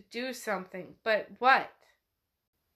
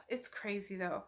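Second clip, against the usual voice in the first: ordinary delivery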